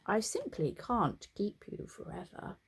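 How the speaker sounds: usual speaking voice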